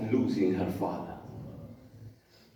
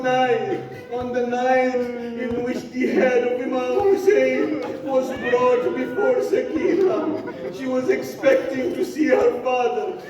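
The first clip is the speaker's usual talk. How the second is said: mournful majlis recitation; 11 semitones higher, 4 dB louder than usual. Acted. Preacher recites the grief of Zahra and Sakina, lamenting. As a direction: mourn the loss aloud for the gathering.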